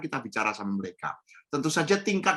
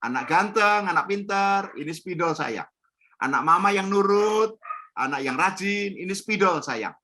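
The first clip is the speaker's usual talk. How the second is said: put-on praising a child; 6 semitones higher than usual. Shown as a demonstration. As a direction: coo to the child, then answer. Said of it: "Pakai dua aja paling banyak". (memuji anak dengan suara manis)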